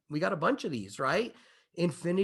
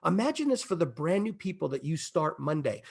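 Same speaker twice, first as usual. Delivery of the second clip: normal talking voice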